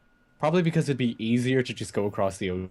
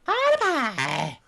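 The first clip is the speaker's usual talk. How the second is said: much higher, slow squeaky echo; silly mocking voice; 13 semitones higher, much slower than usual. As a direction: drag the name out in a squeak. Echoing the mocking name.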